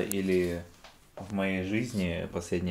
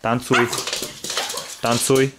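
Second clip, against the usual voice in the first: firm loud command сидеть; firm dog commands; 4 semitones higher, 5 dB louder than usual. Real, not acted. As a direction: bark out the order сидеть. Команды собаке. (твёрдая команда собаке)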